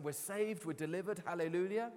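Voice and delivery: imagine them celebrating rescue; mock celebration. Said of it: "they'd be celebrating".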